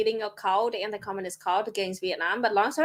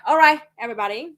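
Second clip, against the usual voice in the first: host announcement; 5 dB louder, faster than usual. Real, not acted. Host addresses stream audience.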